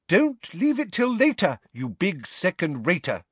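high, scolding novelty voice; chiding talking book. A narrator's reading. The enchanted planner nags Harry, chiding. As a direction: scold like a nagging homework planner. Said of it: "chided the book".

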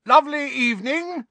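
very high, loud sing-out greeting; cheery shout. A narrator's reading. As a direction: call out to the neighbours with forced cheer. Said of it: "shouted Uncle Vernon".

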